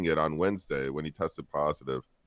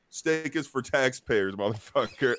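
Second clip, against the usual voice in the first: higher, slow, taunting delivery; a brash local sneering at the shopper; mock Philly tough guy; 7 semitones higher than usual. Stranger taunting a shopper over a steak, slow mock sneer.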